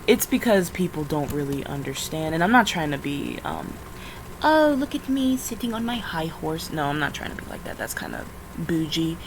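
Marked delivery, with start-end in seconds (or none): none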